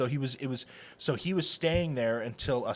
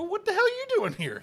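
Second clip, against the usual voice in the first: mock startled outburst; 5 dB louder than usual. Retold shock at detective.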